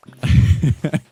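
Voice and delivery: loud breathy laugh bursting out; sudden loud cackle; burst of laughter. Real, not acted. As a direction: crack up at your own joke. Laughs after offensive joke.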